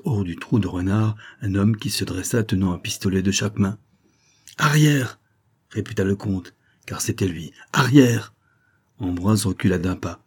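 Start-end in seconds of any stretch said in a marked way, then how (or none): 4.6-5.2 high-pitched, raised-voice order; sharp command. A narrator's reading. Count with pistols orders the valet back, commanding. 7.7-8.3 high-pitched, raised-voice order; sharp command. A narrator's reading. Count with pistols orders the valet back, commanding.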